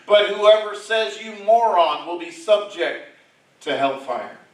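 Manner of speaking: loud and high, proclaiming the warning; loud preaching. Acted. Preacher warning congregation about hellfire, booming proclamation. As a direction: thunder it out from the pulpit. First loud reading of verse.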